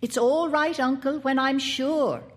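put-on a keen little boy pleading with his uncle; boy Paul. A narrator's reading. Narrator voices the boy Paul.

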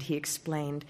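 ordinary delivery, nothing marked

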